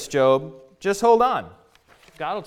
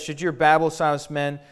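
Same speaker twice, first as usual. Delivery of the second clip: ordinary talk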